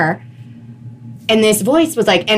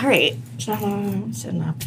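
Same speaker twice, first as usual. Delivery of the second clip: quiet self-talk; 9 dB quieter than usual. Retold or imitated. Woman recalls obeying the voice, quiet self-talk. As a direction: replay a hushed reply to yourself.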